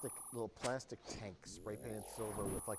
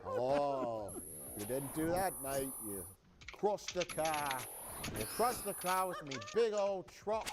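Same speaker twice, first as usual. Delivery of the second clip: a hammed-up, bad Australian accent, a bit louder; 3 dB louder than usual. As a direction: play a cartoon Aussie bloke with an Australian accent, grinning. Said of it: "my Australian accent".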